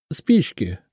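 peevish, snappy woman's answer; irritated. A narrator's reading. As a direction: answer with annoyance. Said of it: "раздражённо ответила Лидочка".

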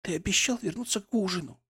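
hoarse upset woman. Acted. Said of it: "Голос у неё был хриплым".

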